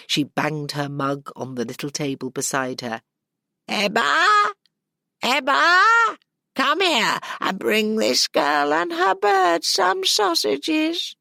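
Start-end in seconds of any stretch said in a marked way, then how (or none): 3.7-11.1 high, loud crone shout to the back room; old woman calling out. Acted. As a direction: bellow for her daughter. Old woman calls her daughter.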